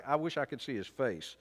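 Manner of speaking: ordinary talk, nothing marked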